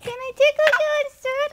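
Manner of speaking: high playful singsong tickling; giggly tickle attack. Real, not acted. Parent tickling the toddler.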